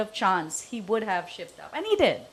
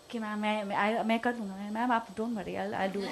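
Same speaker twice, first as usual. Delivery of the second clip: meek junior's reply; 7 dB quieter, much faster than usual. Retold or imitated. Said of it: "His approach was always this".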